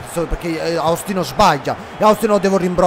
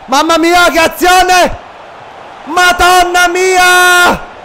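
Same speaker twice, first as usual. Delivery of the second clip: thrilled cry, much higher and louder; excited exclamation; 10 semitones higher, 7 dB louder, much slower than usual. Real, not acted. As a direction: gasp it out, amazed at the play. Reacting to a great play.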